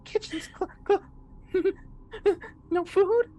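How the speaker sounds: squeaky broken-up monster; soft monster voice. Acted. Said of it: "the softer voice goes".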